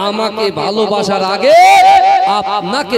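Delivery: high, loud fiery sermon shouting; preaching at full force. Acted. Preacher shouting God's warning to the young men of Lalanagar. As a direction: thunder it out to the whole crowd.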